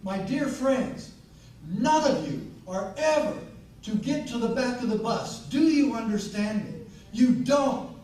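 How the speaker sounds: loud, high, forceful speech to a crowd; impassioned rally oratory. Acted. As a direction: drive it home like a fiery stump speaker. Rallies audience at full force.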